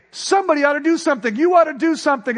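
fast, high, loud insisting; mock nagging. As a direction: hammer the demand again and again. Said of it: "having Pastor Rutland say".

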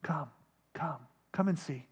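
soft, low, spaced-out invitations; hushed, unhurried coaxing; Jesus' gentle call. Acted. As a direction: beckon quietly, pausing between calls. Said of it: "Jesus is always saying".

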